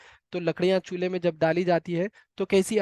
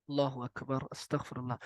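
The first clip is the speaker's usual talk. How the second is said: murmured much softer and lower, in awe; hushed istighfar; 6 semitones lower, 12 dB quieter than usual. Real, not acted. Preacher to the women listening, hushed and awed. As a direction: breathe the words low, shaken by the image. Awe after the seventy-parts hadith.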